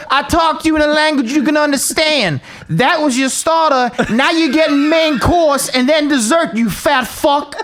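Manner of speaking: high, hammed-up coach's tirade; berating coach. Coach ordering trainee to repeat the circuit, mock angry tirade. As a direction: tear into him, drawing out the final insult.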